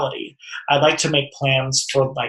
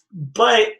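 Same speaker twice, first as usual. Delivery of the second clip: ordinary talk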